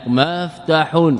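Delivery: مفتح drawn out slowly as maaf-tahun; two fathas. Shown as a demonstration. Teacher showing the finished derived word, slow careful demo. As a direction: say مفتح slowly with a full ending, maf-tahun. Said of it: "زدنا ميما مفتوحة وفتحنا العين".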